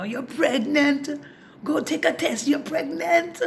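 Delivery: put-on slow, high, loud insistent Jamaican accent. Father insisting to his doubtful daughter, Jamaican accent. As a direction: insist slowly in a Jamaican lilt. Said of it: "He's Jamaican".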